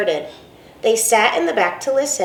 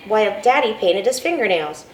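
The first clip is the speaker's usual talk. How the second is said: usual speaking voice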